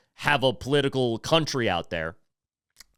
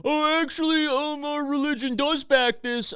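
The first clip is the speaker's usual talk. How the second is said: mocking opponent voice; 11 semitones higher than usual. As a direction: sneer it back in a high put-on voice.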